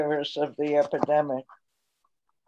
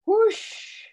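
sudden high swooshing whoosh; whoosh sound. Acted. Poet voicing the cat darting away, high whoosh.

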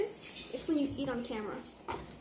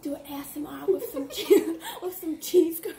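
laughing; 5 dB louder than usual. Real, not acted. Laughing through the request.

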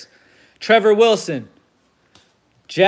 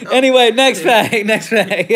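laughing; 4 semitones higher, 5 dB louder than usual. Real, not acted. Laughing after the impression.

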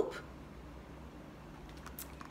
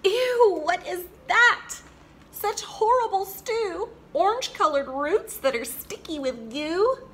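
high, loud grossed-out squeal; disgusted; 8 semitones higher, 4 dB louder than usual. Acted. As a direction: recoil from the goo in disgust. Frog disgusted by honey carrots.

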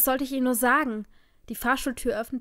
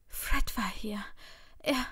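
tearful faltering; 3 dB quieter, slower than usual. A narrator's reading. Tearfully tells partner what happened.

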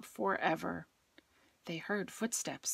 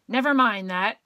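loud sharp snap; snapped; 3 semitones higher, 10 dB louder than usual. A narrator's reading. Miss Swamp snapping at the kids, loud. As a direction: cut them off hard. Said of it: "snapped Miss Swamp".

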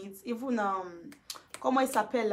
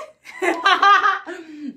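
high burst of laughter; big high cackle; loud laugh; 7 semitones higher, 9 dB louder than usual. Real, not acted. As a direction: crack up loudly. Bursts out laughing after quote.

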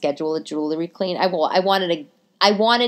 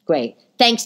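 ordinary talk

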